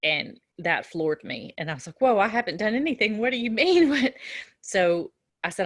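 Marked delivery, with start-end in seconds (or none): 2.0-4.1 fast, louder, higher startled defense; shocked protest. Retold or imitated. Nurse to mother, shocked and defensive. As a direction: blurt it out, caught off guard.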